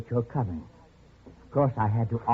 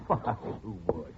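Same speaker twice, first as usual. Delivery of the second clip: scornful laugh; 8 semitones lower, 9 dB quieter than usual. Acted. Captor mocks prisoner's fear.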